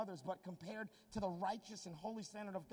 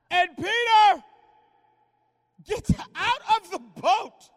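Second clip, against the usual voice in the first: high, loud awed sermon build; preacher shouting in wonder; shouted preaching climax; 18 dB louder, slower than usual. Acted. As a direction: hammer home the miracle.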